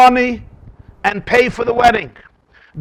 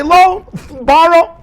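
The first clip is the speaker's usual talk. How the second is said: mock eager lender; 9 semitones higher, 4 dB louder, slower than usual. Others say, quoted.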